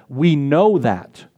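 know drawn out and slow; slow, press hard on know; stress on know. Shown as a demonstration. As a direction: lean into know, slowly. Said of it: "emphasizing the first part of that".

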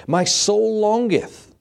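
high, slow, yearning voice; longing psalmist. Acted. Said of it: "longing for the presence".

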